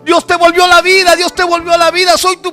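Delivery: high, loud fatherly calling; reenacted emotional shouting to a son; urgent calling to son. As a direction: call your child back, insistent and overwhelmed. Father calls to reviving son.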